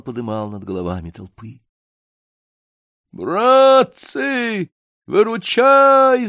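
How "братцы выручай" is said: high, long-drawn bellow; yelling for help. Acted. Гундосый to his comrades as he is overpowered, yelling. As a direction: holler desperately for rescue. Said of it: "заорал Гундосый".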